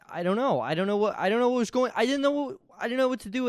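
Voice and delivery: winding up into exasperated fury; building frustration. Retold or imitated. Recalling the editing mess, climbing into exasperation. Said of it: "and it made me furious".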